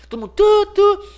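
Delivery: high loud honking; mock car horns. Drivers honking back, loud imitated horns. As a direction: honk like a traffic jam.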